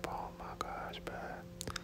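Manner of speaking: usual speaking voice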